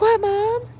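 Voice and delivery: high, imitated child's voice; smiling child. Daughter asking mom what's wrong, imitated high, smiling voice. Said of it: "And she's smiling".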